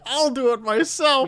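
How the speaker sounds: high-pitched mock old-man voice; feeble old man. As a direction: play a poor, feeble old fellow. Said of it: "Just this poor feeble old man".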